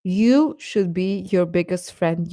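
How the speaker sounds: ordinary talk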